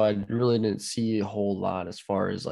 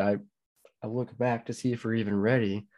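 ordinary delivery

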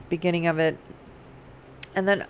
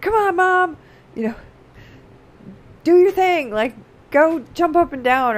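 put-on much higher, louder little-boy voice; young son urging; 9 semitones higher, 8 dB louder than usual. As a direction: cheer Mom on like an eager kid. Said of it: "he's like".

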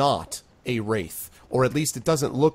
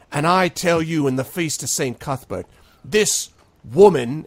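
louder, slightly raised public accusation; accusing speech; 5 semitones higher, 6 dB louder than usual. Acted. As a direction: point at her and denounce. Sharky accuses the mayor.

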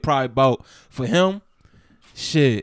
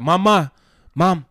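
put-on toddler calling mama; 5 semitones higher, 6 dB louder, much slower than usual. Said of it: "he'd be like".

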